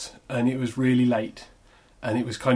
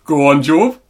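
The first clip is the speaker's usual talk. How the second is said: louder mock heckle; heckle again; 3 semitones higher, 12 dB louder, slower than usual. Heckler repeats his line to the performer, mock loud. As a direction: call it out proudly, loud. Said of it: "And he was like".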